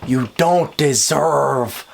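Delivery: very slow, drawn-out verdict; slow, heavily weighted pronouncement; emphatic rebuke. Acted. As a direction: stretch every word out to drive it home.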